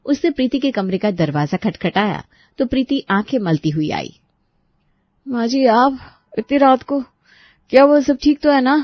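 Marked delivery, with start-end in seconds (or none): 5.3-8.7 put-on higher, worried young bride woken at night; concerned daughter-in-law answering the door; sleepy bride, surprised and concerned. Daughter-in-law Preeti voiced.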